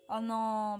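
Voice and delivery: ordinary talk, nothing marked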